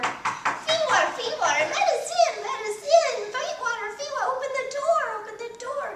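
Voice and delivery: high, loud spirit shrieks; shrieking spirits. A narrator's reading. Crowd of spirits shrieking at the door. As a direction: hammer at the door with your voice. Many voices knocking.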